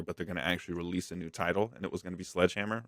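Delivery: usual speaking voice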